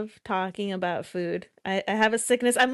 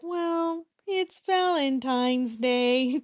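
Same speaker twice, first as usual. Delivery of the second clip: slow, higher-pitched mopey puppet voice; Kermit; 4 semitones higher, much slower than usual. Retold or imitated. Teasing co-host about sounding sad, mopey Kermit imitation.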